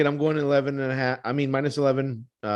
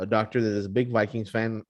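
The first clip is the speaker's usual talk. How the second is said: ordinary talk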